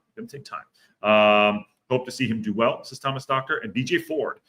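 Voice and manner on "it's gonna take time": whispered. Shown as a demonstration. Repeats reassurance, whispered.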